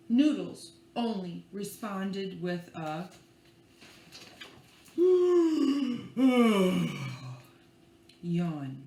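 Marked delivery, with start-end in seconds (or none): 5.0-7.4 long loud sleepy sigh; heavy sigh. Acted. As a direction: sigh like you're drifting off. Noodles responds sleepily.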